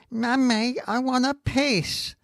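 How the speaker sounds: put-on high little boy's whine; a high child voice; child's voice. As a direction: play the small boy blurting it out. Child voice in told joke.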